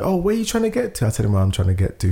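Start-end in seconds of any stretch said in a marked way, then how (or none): 0.0-1.1 high, quick, helpful voice; imitating the cute helpful guy; friendly stranger. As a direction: offer help cheerfully. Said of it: "he's like".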